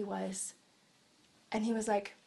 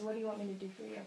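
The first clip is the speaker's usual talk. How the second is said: much softer, gentle ask; soft question; 11 dB quieter, much faster than usual. Pretend. Jesus answering the blind man, soft gentle question.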